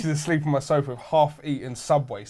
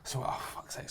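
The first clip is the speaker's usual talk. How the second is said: a muttered sigh, almost voiceless; whispered exasperation; 3 semitones lower, 19 dB quieter than usual. Real, not acted. Whispering fed up, retelling the fight to mates. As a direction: breathe it out under your breath. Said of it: "whispered exasperation".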